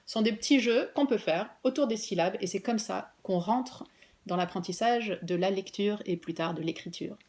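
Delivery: ordinary talk, nothing marked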